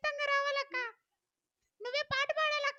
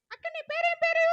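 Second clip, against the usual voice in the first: louder, eager call; calling out a question; 3 semitones higher, 8 dB louder than usual. Acted. Child loudly asking the woman her name across the room.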